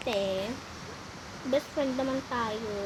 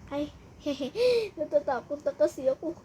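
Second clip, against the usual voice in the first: ordinary talk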